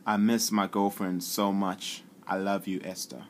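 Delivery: slow, clear dictation into the app; dictating to the phone. Shown as a demonstration. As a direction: speak slowly and clearly for the speech recognition. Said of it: "Let me just say this".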